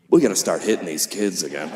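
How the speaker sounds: deadpan. Retold or imitated. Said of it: "look you in the eye and say".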